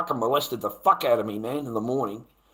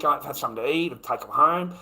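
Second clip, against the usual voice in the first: normal talking voice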